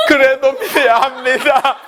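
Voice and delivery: slow, high, strained 그래도 믿어야 합니다 in a put-on voice; 그래도 dragged slowly and high, a caricature; wrong way, mock overdone. Shown as a demonstration. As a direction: ham it up with a mocking laugh, the way not to do it. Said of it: "데보라 집사님처럼 하면 안 돼".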